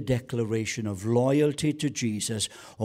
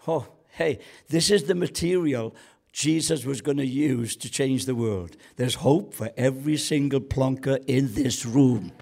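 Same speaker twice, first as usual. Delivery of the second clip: normal talking voice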